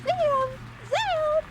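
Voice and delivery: high squeaky call. Acted. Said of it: "really squeaky voice".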